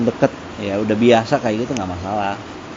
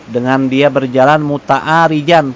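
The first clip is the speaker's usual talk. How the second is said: slow reading; 5 dB louder, slower than usual. Shown as a demonstration. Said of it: "reading Arabic text slowly".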